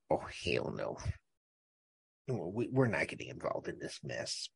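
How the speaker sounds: very low, hushed aside; imitating experts quietly backing out; muttered mock refusal. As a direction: mutter it like a reluctant expert at the back. Imagined experts refusing to testify.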